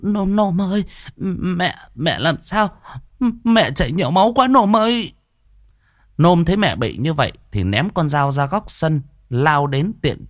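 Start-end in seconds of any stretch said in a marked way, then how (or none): 0.0-5.1 high-pitched frightened mother; halting words rising into a high wail; faltering, garbled cry. A narrator's reading. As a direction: stammer out a wail for help. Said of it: "Bà ú ớ".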